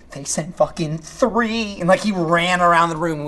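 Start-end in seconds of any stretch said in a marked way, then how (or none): 0.1-1.8 slow disbelief. Retold or imitated. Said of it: "I'm like".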